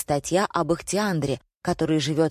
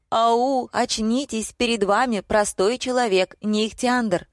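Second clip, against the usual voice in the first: higher-pitched, insistent plea; pitched-up urging; exasperated appeal; 6 semitones higher than usual. A narrator's reading. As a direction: call them to their senses. Pleading with crazed customers to wake up.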